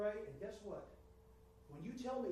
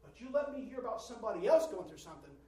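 usual speaking voice

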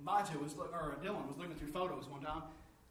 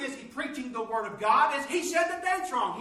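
loud, high preaching at full force; 8 semitones higher, 11 dB louder than usual. Real, not acted. Preacher crying out to the congregation, loud and high. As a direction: preach it hard to the church.

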